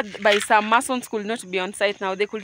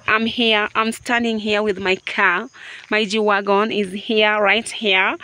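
normal talking voice

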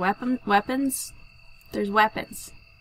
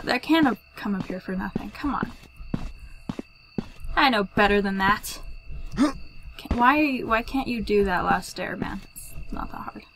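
usual speaking voice

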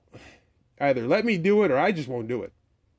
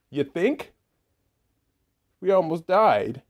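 ordinary talk

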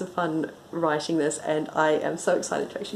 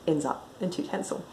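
usual speaking voice

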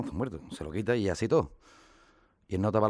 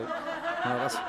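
laughing through words; much slower than usual. Real, not acted.

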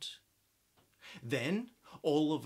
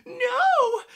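high, loud panicked no; witch's frightened cry; 11 dB louder than usual. Acted. Witch flees the dragon, high loud panicked cry. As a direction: scream no in fright. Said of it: "cried the witch".

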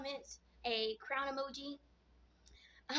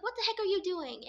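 higher-pitched mock scolding; retold mad brother; 7 semitones higher, 4 dB louder than usual. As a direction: imitate an annoyed brother demanding answers. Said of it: "he's, like, really mad at me".

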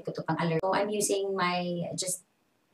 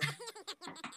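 laugh; 13 semitones higher, 17 dB quieter than usual. Real, not acted. Laughs at own acting.

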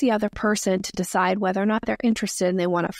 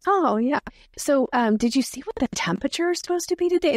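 lightly acted breezy deflection, higher and quicker by the end; offhand, pitch lifting into the question; casual subject change; 5 semitones higher than usual. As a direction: breeze off onto small talk. Acting someone changing the subject.

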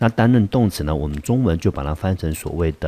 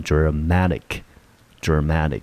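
"dramatic" said slowly with the "ma" syllable hit hardest; stress on second syllable; much slower than usual. Shown as a demonstration. Teacher models "dramatic" for students, slow with stressed "ma". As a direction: say "dramatic" twice, slowly, leaning on "ma". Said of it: "重音节落在第二个音节".

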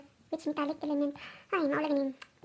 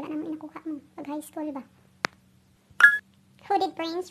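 ordinary talk

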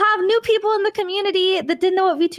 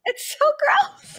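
disgusted whine; 10 semitones higher, much slower than usual. Acted. Disgusted drawn out.

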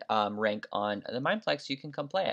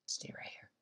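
unvoiced whisper; 17 dB quieter than usual. Real, not acted. Gamer whispering to herself while hiding. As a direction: whisper to yourself to stay put. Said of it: "I don't know why I'm whispering".

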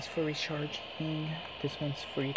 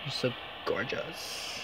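soft whisper; 5 semitones lower, 4 dB quieter, slower than usual. Real, not acted. Softly complimenting the spirit.

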